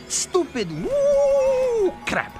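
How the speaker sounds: high, drawn-out spooky wail; scornful mockery. Interpreter dismissing soothsayer's mystical rambling, scornful mockery. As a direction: stretch the woo high and long in mockery.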